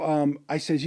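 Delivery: normal talking voice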